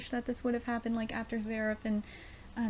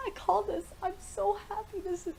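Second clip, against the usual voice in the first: emotional high voice; 11 semitones higher than usual. Acted. Reading tearful death scene.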